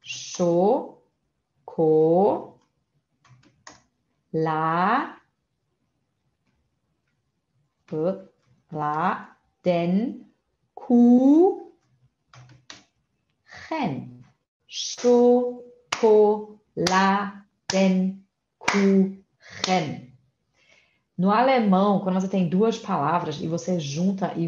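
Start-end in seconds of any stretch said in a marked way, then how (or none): 0.0-14.1 isolated syllables with long pauses between; sílaba por sílaba. Shown as a demonstration. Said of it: "aqui é uma sílaba". 14.7-20.1 "Schokoladenkuchen" very slowly, in six separate beats; sílaba por sílaba. Shown as a demonstration. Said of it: "separe elas em sílabas".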